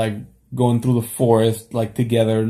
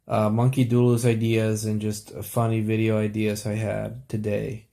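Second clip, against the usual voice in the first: normal talking voice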